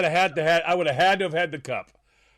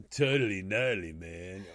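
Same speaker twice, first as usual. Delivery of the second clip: lower-pitched California surfer drawl; lazy Californian dude accent; 8 semitones lower, 5 dB quieter, slower than usual. Acted. Co-host showing how California says it, California accent. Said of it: "If you're California".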